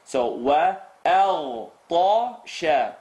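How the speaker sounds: wa-al-tasha very slow, waw light, alif kept; light waw, full alif. Shown as a demonstration. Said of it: "Ta is heavy".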